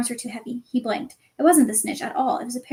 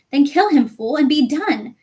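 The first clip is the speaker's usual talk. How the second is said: loud furious screech, slower at the end; 3 semitones higher, 6 dB louder than usual. Acted. Voldemort screeching at Quirrell, furious. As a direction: screech the order in rage. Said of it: "screeched Voldemort".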